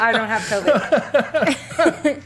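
slow and drawn out through laughter; laughing. Real, not acted. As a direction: giggle through it slowly. Joking after tasting hot.

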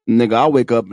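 ordinary talk

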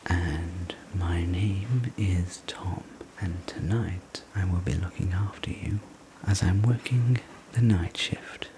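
ordinary delivery

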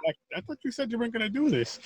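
playfully accuse, voice pitched well up; mock accusation. Acts out teasing viewer's accusation.

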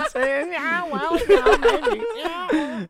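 high squeaky husky puppy voice; goofy high cartoon critter voice; weird cartoon voice. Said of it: "I'm doing some weird ass voice right now".

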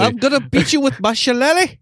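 put-on high, comic stab at an Irish accent; mock-Irish bluster; attempted Irish accent. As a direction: threaten playfully in a badly faked Irish accent. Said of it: "I can't do an".

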